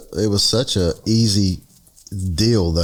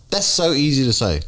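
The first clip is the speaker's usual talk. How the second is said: normal talking voice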